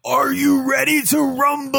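high, slow, shouted rumble call; ring announcer. Pretend. Mock arena announcer call.

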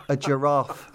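usual speaking voice

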